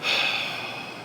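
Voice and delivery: real long sigh of frustration; exasperated sigh. Real, not acted. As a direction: let out an exasperated breath. Frustrated after outburst.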